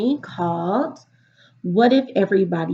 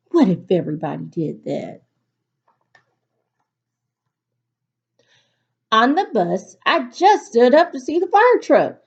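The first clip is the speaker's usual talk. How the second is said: put-on lively storybook read-aloud for kids; reading to children; 4 semitones higher, 4 dB louder than usual. A narrator's reading. Teacher reading a picture book to her class, lively storytelling.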